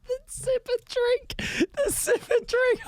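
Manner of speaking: high, thin squeak through laughter; helpless laughter. Real, not acted. Cracking up at co-host's sip.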